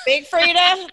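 big excited jump up in pitch and volume. Real, not acted. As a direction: blurt the name out with real delight. Excited reaction to Big Frida mention.

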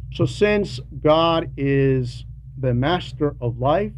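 ordinary talk, nothing marked

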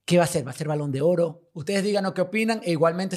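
ordinary talk, nothing marked

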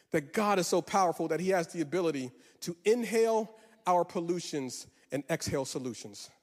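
ordinary talk

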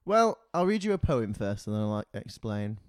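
ordinary talk, nothing marked

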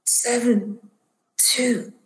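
slow raspy drone turning to a high, drawn-out plea; rasping. Acted. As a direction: beg in a strained, entranced rasp. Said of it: "he's still rasping".

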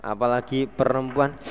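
ordinary talk, nothing marked

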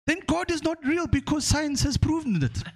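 put-on high, self-satisfied Nigerian-accented verdict; smug Nigerian professor. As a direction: deliver it as the final proof. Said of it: "the guy goes".

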